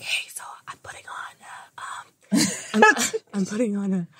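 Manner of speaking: hushed, breathy aside; imitating a coworker's furtive whisper; confiding whisper. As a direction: whisper it like a secret plan. Coworker confiding his fake accent.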